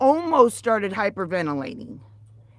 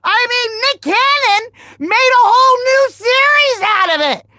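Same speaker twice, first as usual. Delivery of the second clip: loud, high comic rant; rising outrage, played for laughs; 11 semitones higher, 8 dB louder than usual. Real, not acted. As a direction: rant it up at the chat. Ranting about being exposed.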